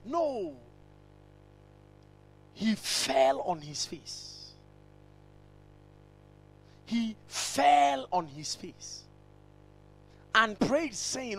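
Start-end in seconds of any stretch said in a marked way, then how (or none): 2.5-9.0 slow. Real, not acted. Preacher dwells on posture.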